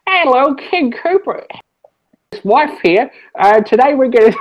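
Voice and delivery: high-pitched comic dub character; put-on dub voice. Shown as a demonstration. Host demonstrating a mocking TV dub, high put-on voice. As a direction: pitch it way up, drawing out the greeting. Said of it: "no, or just like".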